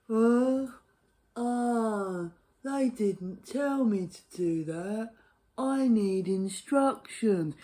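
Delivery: slow, lightly voiced confused dithering; mock dithering person. Mocking dithering townsfolk, slow confused voice. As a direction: play a helpless person needing instructions. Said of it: "people talk like that".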